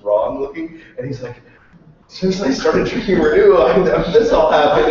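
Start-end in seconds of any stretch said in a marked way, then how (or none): 2.1-4.9 mock earnest voice. Quoting the sideburn guy.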